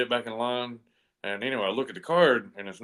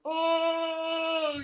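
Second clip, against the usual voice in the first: startled yelp. Retold or imitated. Colliding with first sergeant, captain.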